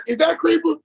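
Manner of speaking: high put-on voice of the woman calling him; imitating caller lady.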